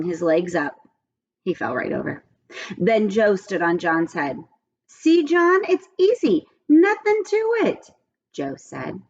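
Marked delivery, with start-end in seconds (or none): 4.9-7.9 put-on high, loud boastful kid voice; cocky Joe. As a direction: brag like a kid showing off. Joe showing off on head.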